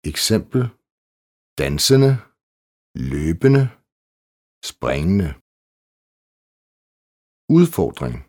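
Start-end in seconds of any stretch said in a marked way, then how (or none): 1.6-5.3 dansende, løbende, springende with -ende said without a D; silent D. Shown as a demonstration. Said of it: "Bøjningsendelsen". (stumt D i dansende, løbende og springende)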